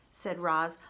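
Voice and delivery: usual speaking voice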